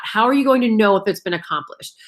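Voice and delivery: normal talking voice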